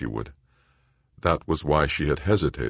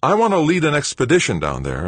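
high, loud and eager; keen, emphatic lift in the voice; brightening; 12 semitones higher, 6 dB louder than usual. A narrator's reading. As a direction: brighten up, full of purpose. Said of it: "his tone brightening immediately".

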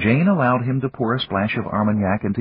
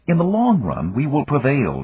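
firm and certain, pitched a bit higher; firmly; 7 semitones higher than usual. A narrator's reading. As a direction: state it as a settled fact. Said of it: "Jane said firmly".

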